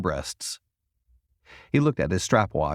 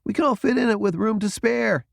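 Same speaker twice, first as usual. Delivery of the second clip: eager Jason; 12 semitones higher than usual. A narrator's reading. Jason argues.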